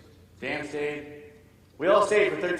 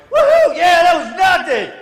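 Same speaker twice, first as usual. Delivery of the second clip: very high, loud adrenaline whoop; exhilarated, cocky yell; elated whooping; 18 dB louder, slower than usual. Retold or imitated. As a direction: shout it like a thrill-seeker after a near miss. Retells his elated shout after blast.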